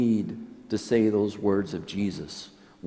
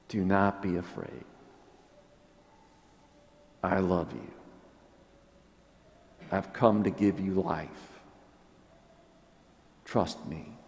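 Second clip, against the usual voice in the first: softer, gentle words of comfort; tender reassurance; 4 dB quieter than usual. Retold or imitated. Preacher voicing Jesus comforting the fearful, soft and tender. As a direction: speak them like a hand held out.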